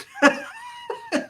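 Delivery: high squealing laugh; a sudden shrill burst of laughter. Real, not acted. Laughs at the story.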